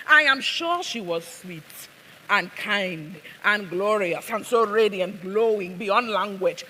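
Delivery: put-on emphatic, accented stage delivery; Abasiama. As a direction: play an accented woman laying on praise. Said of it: "she speaks in an accent".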